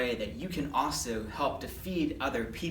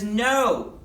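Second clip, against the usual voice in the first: high, loud shouted answer; acted shout across the water; calling back; 6 semitones higher, 7 dB louder than usual. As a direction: holler it back to the shore. Disciples shout back from boat.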